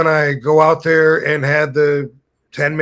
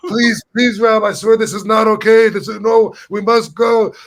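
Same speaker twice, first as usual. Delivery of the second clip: higher, frantic begging; scared pleading; 8 semitones higher, 4 dB louder than usual. Retold or imitated. Scared friend begging Rob to leave the restaurant, frantic. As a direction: plead with him in a panic to leave. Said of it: "He was, like, pissing his pants".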